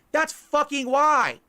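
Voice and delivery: loud, slow furious rant; angry outburst. Real, not acted. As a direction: yell at the trolls, words spaced out.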